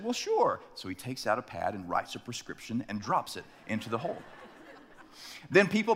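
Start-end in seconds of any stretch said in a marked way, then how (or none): 0.0-0.6 high breezy "well, sure"; casual doctor brushing it off; lightly voiced obliging doctor. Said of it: "And the doctor said".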